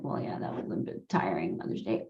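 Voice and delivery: low, soft grumbling complaint; mock moaning. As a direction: groan it out like a tired whiner. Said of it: "bitch and moan and be like".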